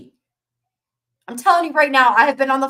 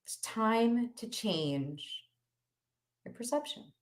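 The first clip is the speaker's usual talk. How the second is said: slow, much softer punchline; dramatic hush; 14 dB quieter, slower than usual. Acted. Coach landing her key message to healers, hushed and slow. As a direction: drop it to a near hush for effect. Dramatic drop after rant.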